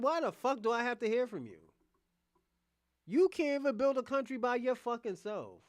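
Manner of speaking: ordinary talk, nothing marked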